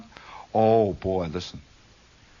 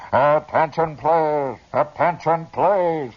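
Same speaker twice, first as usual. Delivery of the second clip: higher, booming stadium loudspeaker imitation; echoing PA voice; 4 semitones higher, 5 dB louder, much slower than usual. Teenage announcer hearing his voice come back, imitated PA echo. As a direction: mimic your own announcement bouncing back. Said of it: "I could hear".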